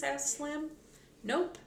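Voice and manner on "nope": chirpy, higher-pitched reply; cheerful. A narrator's reading. Girl answering that she has no questions, cheerful. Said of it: "said Annie cheerfully".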